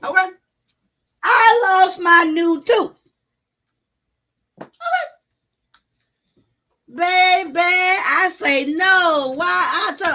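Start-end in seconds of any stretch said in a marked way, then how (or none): none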